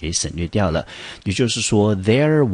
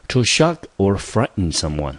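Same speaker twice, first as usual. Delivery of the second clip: slow, careful read of the definition; 3 semitones higher than usual. Shown as a demonstration. Teacher reads English definition to class, slow. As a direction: read it out slowly for learners. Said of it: "来看看它的英文解释".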